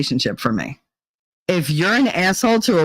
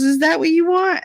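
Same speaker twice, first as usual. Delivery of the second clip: doubtful waitress; 11 semitones higher than usual. Retold or imitated. Waitress checking the kid's order.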